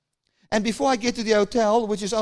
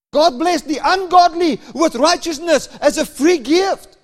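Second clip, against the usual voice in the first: louder, rising sermon delivery; fervent preaching; 4 semitones higher, 5 dB louder than usual. Acted. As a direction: preach it out to the crowd. Preaching at full force.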